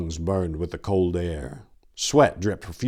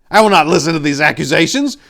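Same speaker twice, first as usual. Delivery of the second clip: defiant; 10 semitones higher, 11 dB louder, faster than usual. A narrator's reading. Answering accuser defiantly.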